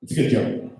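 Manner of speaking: ordinary talk, nothing marked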